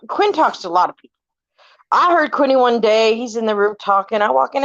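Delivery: ordinary delivery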